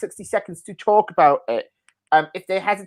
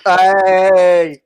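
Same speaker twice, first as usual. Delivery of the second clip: loud, approving shout; delighted hey; 2 semitones higher, 8 dB louder than usual. Real, not acted. Host cheers the fun guy pun out loud. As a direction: cheer the joke.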